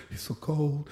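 very soft, almost to himself; hushed aside. Real, not acted. Officer recalling the body during CPR, hushed aside. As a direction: drop right down to a murmur. Haunted aside between retold yells.

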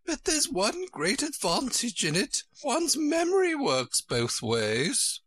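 high, drawn-out queen voice. A narrator's reading. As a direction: explain grandly in a high storybook voice.

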